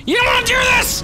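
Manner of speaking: very high, loud taunting scream; shrieked warning. Real, not acted. Gamer warning enemies off, shrieking taunt. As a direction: scream the warning at full height.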